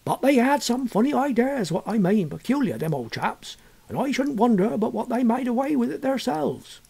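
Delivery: put-on a villager gossiping about the old chaps; hammed-up Suffolk yokel voice; old countryman. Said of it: "was the answer".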